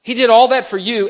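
high, loud preaching climax; preaching at full force. Acted. Preacher lifting the church to a loud, high climax. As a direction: pour it out over the congregation at full force.

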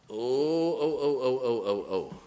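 hammed-up blubbering; mock crying. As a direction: fake sobbing "oh, oh". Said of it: "they didn't do it for me".

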